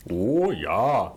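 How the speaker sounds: put-on Montana accent. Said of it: "They're more of a".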